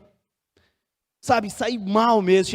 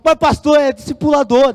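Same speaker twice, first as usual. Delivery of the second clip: higher, louder objection; retold exasperated protest; 4 semitones higher, 6 dB louder than usual. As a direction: push back at the pastor, exasperated. Protesta contra nova demora. (protesto exasperado, reencenado)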